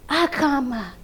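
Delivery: ordinary delivery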